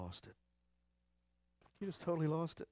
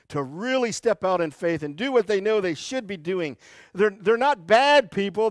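preaching at full force; 7 semitones higher, 9 dB louder than usual. Acted. Pastor urging hesitant Christians, preaching at full force. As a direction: lean on it, rally the pews.

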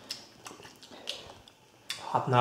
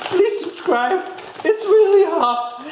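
strained squeak; 12 semitones higher, slower than usual. Real, not acted. Pained outro after the spicy chip.

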